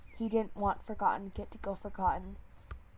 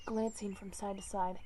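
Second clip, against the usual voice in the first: usual speaking voice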